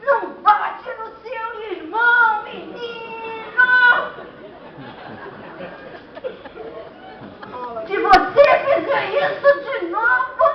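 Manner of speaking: high-pitched, hammed-up angry yell; parent's scolding shout. Parent warning a child who hit his brother, high scolding shout. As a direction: shout a threat like a fed-up parent.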